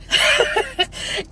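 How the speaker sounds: high-pitched laugh; short, high laugh through emotion. Real, not acted. Speaker admitting to viewers she's been crying, high laugh.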